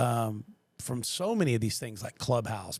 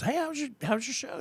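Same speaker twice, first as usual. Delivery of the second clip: much higher, bright voicing of a female coworker; Ashley's voice; 13 semitones higher than usual. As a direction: lift into her cheerful greeting. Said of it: "she goes".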